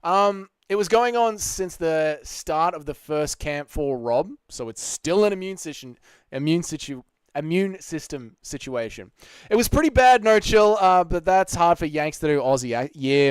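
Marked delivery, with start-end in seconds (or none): none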